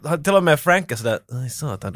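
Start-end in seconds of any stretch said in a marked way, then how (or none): 1.4-1.9 low, hushed acted curse; muted realisation. Bodyguard realising he must think again, low and hushed. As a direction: say it under the breath, conceding.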